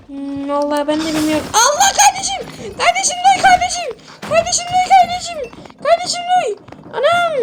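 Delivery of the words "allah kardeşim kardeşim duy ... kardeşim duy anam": panicked yelling. Acted. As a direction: call out in a hammed-up panic to your buddy. Calling friend during strong quake.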